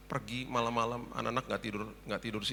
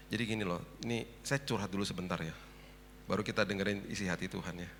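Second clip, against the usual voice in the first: ordinary talk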